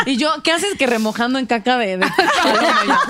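laughing through the words; giggling while talking. Real, not acted. Laughing at the teasing.

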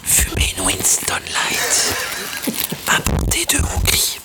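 whispered right in your ear; breathy ASMR whisper, close and intimate. Pretend. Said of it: "je fais de l'A S M R".

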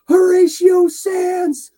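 high, loud and drawn out; re-enacted fan yell; excited celebrity-spotting holler. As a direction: holler the name like a starstruck fan. Greeting a celebrity, retold.

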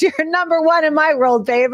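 mock sweet husband. Husband's teasing call while flipping off.

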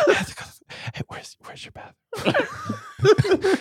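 soft whisper breaking into giggles; ASMR whisper. Acted. Asking where the bathroom is, ASMR whisper. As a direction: whisper up close to the ear. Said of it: "You go right up to the ear".

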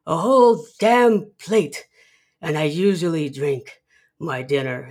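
mock old-man bragging, loud and emphatic; cranky old man. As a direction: hammer it out like a defiant elderly dad. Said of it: "He's like".

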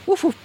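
high hooting woof woof like a barking owl; owl call imitation. Answering a barking owl at night, high woof call. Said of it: "so they go".

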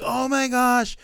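high, slow gushing fan voice; a hammed-up impression of gushing fans; mocking fans. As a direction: parody the fans gushing over it. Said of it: "I know everybody's like".

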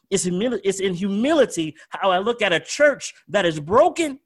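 full-force preaching. Real, not acted. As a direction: preach it home, hammering each line.

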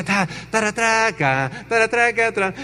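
mouthed music "taratraca"; imitated fanfare. Music as the hosts appear, mouthed fanfare. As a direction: blare out a mock entrance tune. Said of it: "y sale música".

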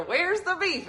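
imitating the old ladies from the ad; old lady voice. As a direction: pitch it way up like a fussy old woman. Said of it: "And then they're".

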